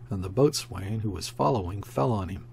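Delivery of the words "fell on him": ordinary delivery, nothing marked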